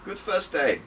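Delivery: bright, high and pleased; a beaming instructor praising a rough first day. Acted. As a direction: beam with pride at the new recruits. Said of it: "I beamed".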